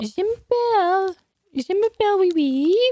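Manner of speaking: put-on high little dog with French accent; French Bichon Frise. Dog introduced to listeners, high French dog voice. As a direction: squeak it out as a French lapdog. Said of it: "speaking to us in the accent".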